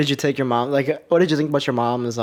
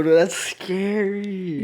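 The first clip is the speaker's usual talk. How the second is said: drawn-out, high-pitched spooked awe; long, high-pitched "whoa" kind of reaction; awed reaction; 7 semitones higher, much slower than usual. Real, not acted. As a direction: stretch it out high, creeped out by the story. Awed reaction to a scary dream.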